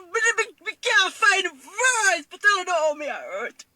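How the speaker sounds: real tearful sobbing, pitch pushed up; words dragged out slowly through tears; crying. Real, not acted. Mother struggling to describe her pain, weeping.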